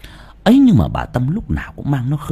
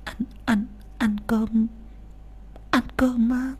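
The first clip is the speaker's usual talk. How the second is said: put-on much higher, softer old woman stammering; an old mother stunned, stumbling over ăn; old mother amazed at a dinner invite; surprised stammer; 12 semitones higher, 9 dB quieter than usual. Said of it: "lắp bắp ngạc nhiên".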